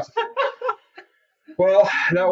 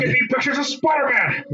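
barking editor shout; 11 semitones higher than usual. Retold or imitated. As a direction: bark it like a newspaper boss. J. Jonah Jameson quote.

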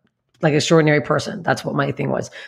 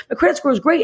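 much higher, eager and insistent; high insistence; 10 semitones higher than usual. Retold or imitated. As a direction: chirp it brightly to convince. Insisting to bank clerk.

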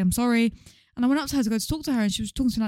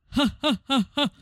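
separate, evenly spaced "ha"s; mock a lazy laugh, one beat at a time; imitated lazy laugh; 4 semitones higher than usual. Teasing friend about his changed laugh, mock lazy laugh. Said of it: "this lazy laugh".

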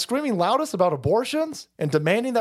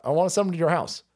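usual speaking voice

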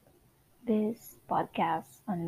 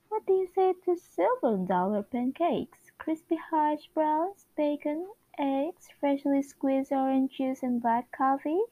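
higher, bright-eyed sitcom housewife read; 7 semitones higher than usual. Acted. Reading the WandaVision script as the chipper husband, higher. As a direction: offer breakfast like a fifties TV show. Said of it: "Talking about accents, Wanda Maximoff's accent".

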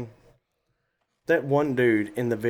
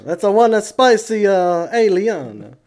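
loud, high, hammed-up Italian accent; exaggerated sing-song Italian accent; mock Italian accent; 9 semitones higher, 10 dB louder than usual. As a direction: belt it out like a cartoon Italian. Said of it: "sounded like an Italian having a stroke".

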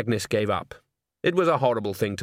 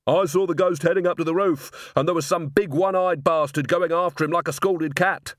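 higher-pitched, eager eyewitness voice; excited stagehand; 6 semitones higher than usual. A narrator's reading. Man with lantern reporting the ghost, excited.

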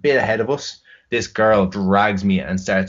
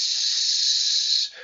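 unvoiced hiss of her peeing; imitated pissing hiss; 3 dB quieter than usual. Coworker relieving herself by the taxi, imitated hiss. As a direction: mimic the hiss of someone peeing.

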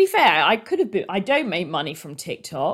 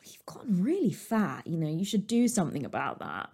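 hushed dad's warning; 6 dB quieter than usual. Retold or imitated. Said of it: "my dad would be like".

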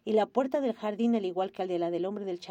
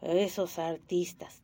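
slow, low and sour; a lightly voiced sour old man; 4 semitones lower, much slower than usual. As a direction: grumble it with distaste. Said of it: "dijo agrio".

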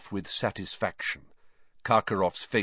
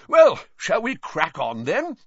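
louder, higher, eager to get going; rubbing his hands, a louder eager push onward; 10 semitones higher, 7 dB louder than usual. A narrator's reading. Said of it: "looked rather excited".

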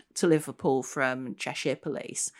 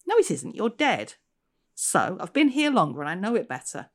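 measured, storytelling read-aloud voice; story narration; 2 semitones higher, 3 dB louder than usual. A narrator's reading. Author reading her novel aloud to an audience. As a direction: draw listeners into the haunted station.

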